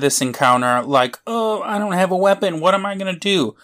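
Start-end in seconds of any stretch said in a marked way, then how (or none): 1.3-3.6 higher, softer helpless whine; mock helplessness. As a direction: play at being helplessly at a loss. Mocking helpless character in encounter.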